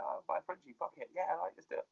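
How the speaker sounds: soft, breathy aside to himself; quiet mock scheming; hushed. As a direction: whisper it like a secret plan. Retold inner decision, hushed.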